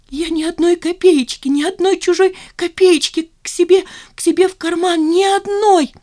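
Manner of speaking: very high wailing denial; hysterical protest. A narrator's reading. Zina denying she pocketed a kopeck, hysterically. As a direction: protest innocence in a high wail.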